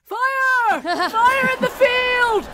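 high shouted warning, slowed; shouting alarm. Acted. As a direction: yell fire across the field. Character raises the alarm.

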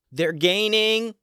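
high, loud and slow warning shout; yelled. Acted. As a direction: call it back so those ahead hear. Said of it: "she yelled loud enough".

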